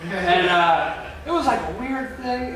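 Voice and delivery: normal talking voice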